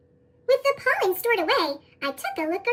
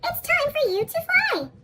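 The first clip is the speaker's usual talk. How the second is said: high squeaky little bee voice; helium voice; 9 semitones higher than usual. Acted. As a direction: chirp it up high like a tiny bee. Friends call the new bee.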